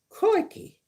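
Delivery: hammed-up Aussie exclamation, way up high; Steve Irwin impression. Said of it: "Steve Irwin used to say that".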